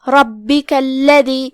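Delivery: ربک الذی slowly, holding the doubled b and l; slow tajweed ربک الذی with heavy ra; held doubled consonants. Shown as a demonstration. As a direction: lean on the shadda in ربک الذی. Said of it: "تو تشدید کا دھیان رہے".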